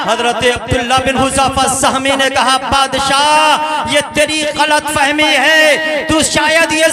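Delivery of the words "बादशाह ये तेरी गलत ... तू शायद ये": slow, stretched call to the king; drawn-out address. Acted. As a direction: draw out the word Badshah slowly. Martyr addresses the king again.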